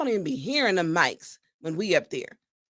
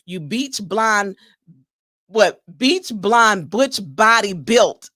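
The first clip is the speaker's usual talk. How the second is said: slow, louder, word-by-word try at the tongue twister; careful tongue twister; 3 semitones lower, 6 dB louder, slower than usual. Shown as a demonstration. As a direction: spell it out slowly so it all gets out. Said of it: "Did I get it all out?".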